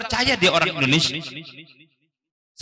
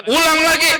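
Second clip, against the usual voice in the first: insistent; 7 semitones higher, 4 dB louder than usual. Acted. Acts examiner failing the pupil.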